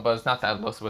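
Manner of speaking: usual speaking voice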